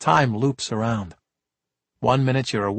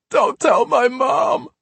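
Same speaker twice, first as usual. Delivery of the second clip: put-on much higher, slow childlike wail; crying plea; 12 semitones higher, 4 dB louder, slower than usual. Sobbing kid begs the cops, wailing. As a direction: cry like a toddler. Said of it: "he cried".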